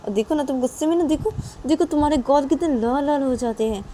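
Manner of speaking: high, cajoling singsong; playful coaxing. Acted. Friend teases angry Nisha about red cheeks, coaxing.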